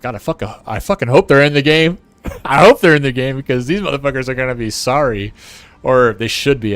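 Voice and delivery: worked-up, defiant retelling of your hope; fired-up hope. Retold or imitated. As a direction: blurt it out loud, relishing the payback. Retells newly fired-up hope, vengeful.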